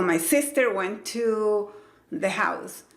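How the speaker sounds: SISTER hit hard, then a slow, halting went to the house; wrong-way demo, pushing sister out; wrongly punched stress. Shown as a demonstration. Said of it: "Yo no digo".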